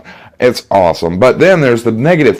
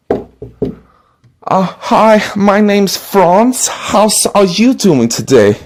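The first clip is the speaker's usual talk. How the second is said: put-on salesman with a thick German accent, slow and polite; a German door-to-door salesman greeting a customer; 10 semitones higher, much slower than usual. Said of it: "German door-to-door salesman".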